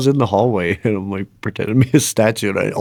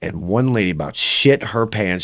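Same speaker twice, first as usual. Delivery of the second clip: ordinary talk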